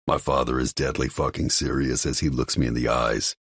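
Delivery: usual speaking voice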